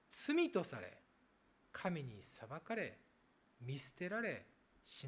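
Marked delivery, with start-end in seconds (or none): none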